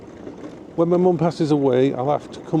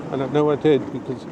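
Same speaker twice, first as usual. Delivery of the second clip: ordinary delivery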